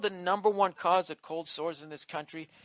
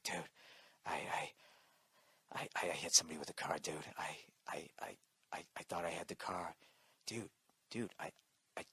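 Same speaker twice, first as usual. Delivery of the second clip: very hushed, halting whisper with stammered restarts; scared whisper; 10 semitones lower, 14 dB quieter than usual. Retold or imitated. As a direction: whisper a panicked confession into a phone, haltingly. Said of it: "He's whispering into my phone".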